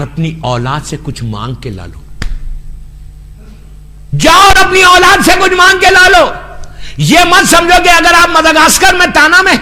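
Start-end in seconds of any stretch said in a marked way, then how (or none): 0.0-2.0 said much softer and lower, almost to himself; hushed command. Acted. Preacher quoting God sending souls to their children, hushed and solemn. God's words to the dead, first said. 4.2-6.3 proclaimed command. Acted. Same words repeated, now proclaimed.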